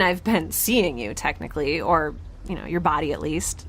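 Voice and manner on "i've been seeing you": ordinary delivery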